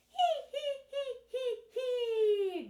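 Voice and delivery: loud, squealed "hee hee" giggle; staged giggle at the top of the voice; very high laugh. Shown as a demonstration. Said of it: "nice and high".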